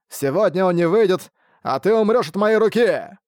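acted outburst of menace; threatening cry. A narrator's reading. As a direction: vow revenge in a high, heated voice. Old man threatens his enemy.

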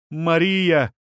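high exclamation; exclaiming. A narrator's reading. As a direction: exclaim the holy name in awe. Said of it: "воскликнула она".

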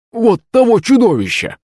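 high, scared whine; nearly crying. A narrator's reading. Servant points at the hydra's head, nearly crying. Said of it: "Захарыч чуть не плакал".

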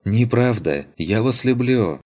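a charming woman teasing back; smiling lady's reply. Acted. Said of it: "улыбнулась та". (с улыбкой, легко и игриво)

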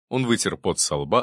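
ordinary delivery

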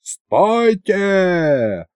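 high, drawn-out call to halt; stretched high cry; mock yell. A narrator's reading. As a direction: call out as if from afar, without full volume. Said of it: "заорал вдруг белобрысый Пашка".

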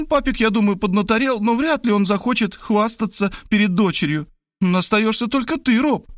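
put-on high girlish voice, reasoning insistently; bewildered young woman. A narrator's reading. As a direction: argue it out, pitched well up. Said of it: "недоумевала она". (высокий девичий голос, недоумённо убеждает)

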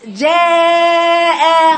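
جاء stretched wide to a long "jeh-eh"; faulty drawn-out جاء with a thinned vowel; sideways-spread mistake. Shown as a demonstration. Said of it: "بعمل العرضي".

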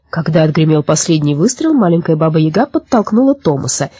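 plain reading voice

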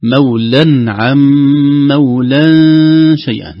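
first مولا with clear n, second melting nasally into شيئا; tajweed recitation. Shown as a demonstration. Teacher demonstrating both tanweens, slow loud recitation. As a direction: chant slowly, holding the hum before شيئا. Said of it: "نون التنوين غير مكتوبة".

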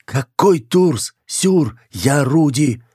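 high, drawn-out bewildered wail; distraught protest. Acted. Girl in a man's body insists who she is, distraught. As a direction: plead to be recognised, high and slow.

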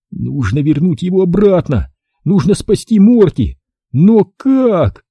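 anxious, rising in pitch; urgent high-pitched fretting; lamenting. A narrator's reading. Boy desperate to rescue his dog, lamenting. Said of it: "запричитал я".

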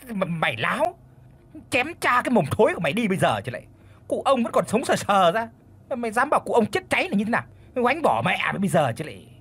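high, rapid-fire threats; furious scolding. A narrator's reading. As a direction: tear into the servant, fast and indignant. Mistress threatens servant who suggested death.

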